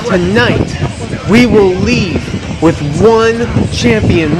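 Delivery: high, hyped ring announcer voice; wrestling promo. Acted. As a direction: sell it like a big fight night.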